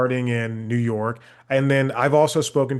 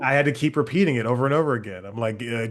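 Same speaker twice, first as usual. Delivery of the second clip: usual speaking voice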